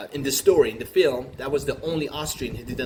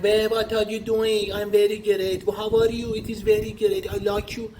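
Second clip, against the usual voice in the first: put-on Persian accent; 9 semitones higher, 4 dB louder than usual. Comedian mimics Iranians speaking English in a Persian accent. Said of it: "the Persian accent".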